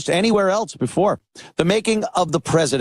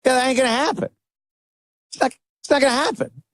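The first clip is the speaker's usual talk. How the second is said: higher, brash Trump impression, fast at the end; imitating Trump; 7 semitones higher, much faster than usual. Host imitating Trump brushing off Australian PM, brash and breezy. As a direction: toss the deal aside like Trump. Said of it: "he's just like".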